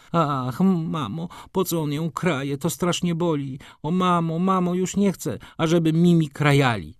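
high, tearful crying plea; a little girl begging her mother through tears; high crying child, pleading; tearful child's plea. A narrator's reading. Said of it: "wybuchnęła płaczem i, wołała".

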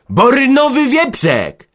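a high, loud, slow furious burst; angry outburst. Acted. Smith bursts out at the women about the pig, angry. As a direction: explode in anger at last. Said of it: "wybuchnął naraz".

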